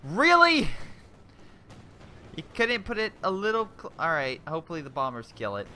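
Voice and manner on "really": sharp high disbelieving really; real jolt of surprise; surprised. Real, not acted. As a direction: blurt it out in shock. Disbelief at game event.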